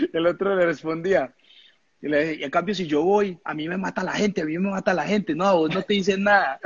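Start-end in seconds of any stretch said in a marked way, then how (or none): 2.4-6.6 teammate refusing. Retold or imitated. Other teammate refuses to kick.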